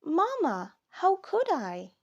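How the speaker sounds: put-on high, pleading child voice; a little one protesting innocence; child's plea. Child insisting to Mama, high and pleading.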